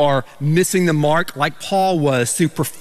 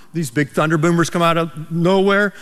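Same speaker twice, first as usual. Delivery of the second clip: normal talking voice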